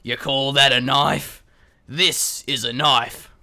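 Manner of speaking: put-on slow, gruff Crocodile Dundee Australian accent. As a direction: growl it slow like an Australian bushman. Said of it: "in our best Australian accent".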